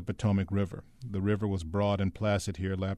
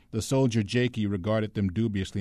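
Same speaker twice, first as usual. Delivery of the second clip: ordinary delivery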